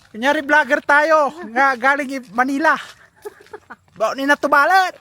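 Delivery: loud, high excited calling; excited shouting. Real, not acted. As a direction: call out to friends.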